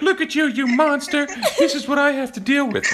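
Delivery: mock scolding partner. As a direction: imitate her scolding in a high voice. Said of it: "she's like".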